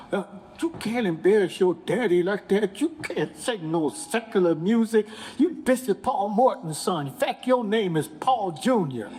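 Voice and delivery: high, scolding family voice; scolding mother. Retold or imitated. Mother and sister scolding the son, in a high scolding voice. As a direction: scold him like an upset mother. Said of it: "they went off on".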